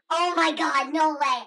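ordinary talk, nothing marked

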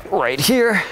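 "right here" pushed out high and tight; effortful high "right here"; strained core voice. Real, not acted. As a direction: squeeze "right here" out while holding the twist. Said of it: "I'm gonna start talking like that".

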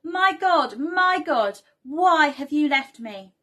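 high, loud acted cry, each phrase called out. A narrator's reading. Jesus crying to God from the cross, acted loud cry. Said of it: "Jesus cried out in a loud voice".

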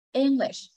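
English as ENG-lish, high start then falling; English with the first syllable prominent; stress on first syllable. Shown as a demonstration. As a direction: lift ENG and let lish drop in English. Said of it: "Giảm xuống huyền nặng".

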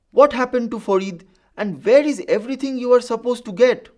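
loud, high scolding question; cross. A narrator's reading. Ma confronts the rickshaw puller at her door, cross and loud. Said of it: "Ma looked at him crossly".

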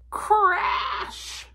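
explosive, drawn-out storybook crash; loud crash. A narrator's reading. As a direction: burst out "crash" as a big smash. Wagon crashes into a stone.

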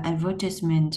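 British advertisement, ad-VER-tis-ment; stress on the second syllable. Shown as a demonstration. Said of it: "là nhấn vần hai". (advertisement giọng Anh, nhấn vần hai)

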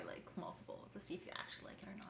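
fast sheepish whisper. Real, not acted. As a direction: murmur the excuse quickly. Defending eating marzipan.

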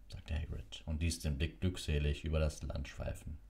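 usual speaking voice

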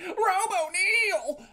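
loud, high, stretched-out name call; excited shout. Real, not acted. Streamer hailing a viewer joining chat, excited shout. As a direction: hail a viewer with a drawn-out shout.

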